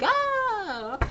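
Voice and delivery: high-pitched drawn-out "aah" scream; mock scream. Speaker mimicking devils running out of hell, mock screaming.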